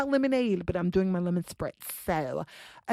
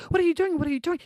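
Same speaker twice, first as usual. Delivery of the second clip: high-pitched, repeated mock protest; alarmed protest; 9 semitones higher, much faster than usual. Date to the undressing teacher, alarmed protest. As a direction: imitate baffled alarm, pitched up.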